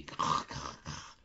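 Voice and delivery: « crrr » soufflé, sans voix, comme une plume qui gratte; grattement de plume imité. A narrator's reading. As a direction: imiter une plume sur le papier. (le narrateur imite l'ange qui écrit, « crrr » chuchoté)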